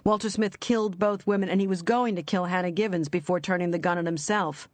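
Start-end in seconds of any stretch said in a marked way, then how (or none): none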